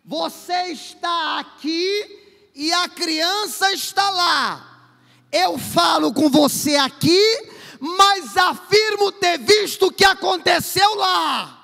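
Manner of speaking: slow, high, loud proclamation; drawn-out preaching. Acted. Preacher telling the church God sees all, slow loud proclamation. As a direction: keep stretching every word like a herald.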